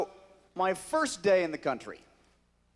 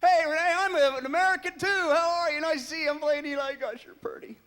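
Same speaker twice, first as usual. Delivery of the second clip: higher, giggly, eager gushing; gushing fan; 13 semitones higher, 5 dB louder, faster than usual. Retold or imitated. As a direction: fawn over the co-star. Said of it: "Renee comes in".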